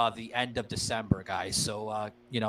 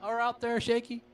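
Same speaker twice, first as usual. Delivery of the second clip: calling out; 9 semitones higher than usual. Real, not acted. Calling out question to Shaky.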